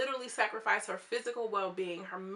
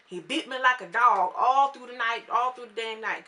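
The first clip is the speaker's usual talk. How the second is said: put-on country accent; 3 semitones higher, 7 dB louder than usual. Quoting mom on the beatings, country accent. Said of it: "this is my country accent".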